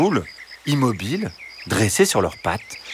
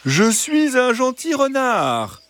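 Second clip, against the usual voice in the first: high, slow, sugary fox; gentle fox voice; slower than usual. Acted. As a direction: reassure them like a kindly fox. Fox voice held.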